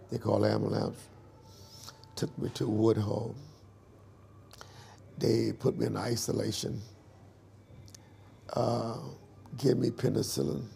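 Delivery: ordinary delivery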